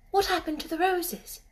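put-on young girl voice. Mary speaks.

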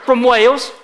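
loud, lightly put-on British accent; projected British lilt with a rising end. Shown as a demonstration. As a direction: model a British accent for the crowd to copy. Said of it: "in your best British accent".

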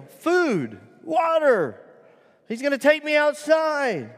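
high, loud yelps of doggy delight; high-pitched excited little dog. Acted. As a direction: voice an overjoyed pet seeing its owner.

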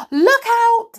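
sudden loud high warning cry; alarmed shout. Acted. Moonface warns of witch cats.

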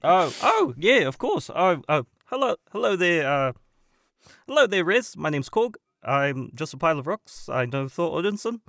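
lilting, friendly rock-creature introduction; Korg. Acted. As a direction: slip into character as a chatty pile of rocks. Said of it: "could you please do that the entire podcast".

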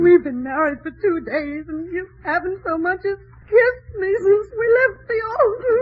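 tearful complaint, words breaking; crying. Acted. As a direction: wail the grievance through tears. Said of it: "please stop crying".

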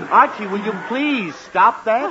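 slow parental scolding; exasperated. Acted. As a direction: stretch out the scolding with strained patience. Father tells son to stop yawning.

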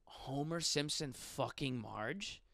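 ordinary talk, nothing marked

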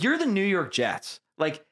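incredulous. Retold or imitated. Incredulous at team's poor job offer.

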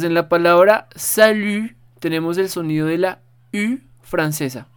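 ordinary talk, nothing marked

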